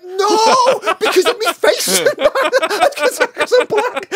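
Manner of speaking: high, loud, laughing through the words; a teen protesting to fellow detainees, laughing. Retold or imitated. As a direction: insist it while cracking up at himself. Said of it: "laughing retelling his reply".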